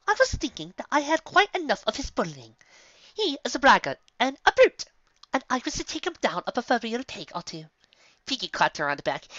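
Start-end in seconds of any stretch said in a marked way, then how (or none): 0.1-7.7 lightly voiced, genteel calm; calm Rarity. A narrator's reading. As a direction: answer coolly, dainty. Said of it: "calmly replying".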